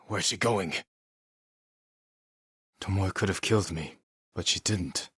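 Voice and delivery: normal talking voice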